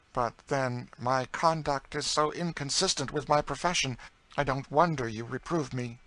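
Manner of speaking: a lightly voiced prim older woman, sincere; earnest spinster aunt. A narrator's reading. Miss Ophelia earnestly answers her cousin's teasing, sincere. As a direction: speak like a conscientious New England lady.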